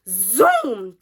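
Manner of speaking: loud, drawn-out zoom; imitated car zoom. Telling y'all how fast the lights came up, loud drawn-out zoom. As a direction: stretch it out like a car rushing up.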